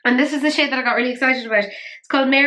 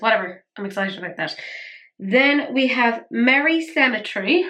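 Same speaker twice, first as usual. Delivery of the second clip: usual speaking voice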